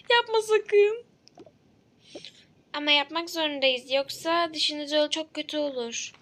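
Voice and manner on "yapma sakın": high, loud begging; frightened patient shouting don't; panicked plea. Acted. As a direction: stop them in panic. Patient pleading with dentist.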